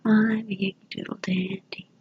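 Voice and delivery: whispered sing-song line; whispered tune. Shown as a demonstration. Teacher modeling the song in your head, whispered. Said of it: "Hum a song in your head again".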